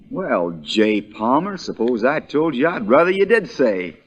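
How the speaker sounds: ordinary talk, nothing marked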